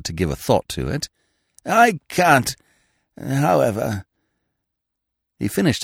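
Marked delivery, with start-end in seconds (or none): 1.7-4.0 put-on high, fluttery voice of a vague old lord; a dithering aristocrat caught off guard, pitched high; flustered old earl. Vague old earl startled by his secretary, high and flustered.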